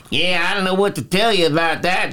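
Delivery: put-on the other backwoods guy's accent; mock rural accent. Replying to his pal at the bar, mock rural accent. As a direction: imitate a second rural local. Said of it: "And then the other guys".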